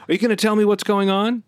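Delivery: high, insistent demand. A narrator's reading. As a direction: push hard for an answer. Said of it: "Jake demanded".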